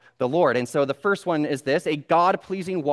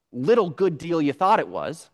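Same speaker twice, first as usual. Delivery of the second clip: normal talking voice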